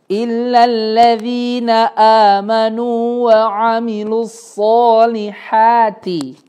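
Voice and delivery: slow, drawn-out tajwid chanting; slow melodic recitation; Quran recitation. Shown as a demonstration. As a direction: recite it with long held vowels. Recites the verse slowly.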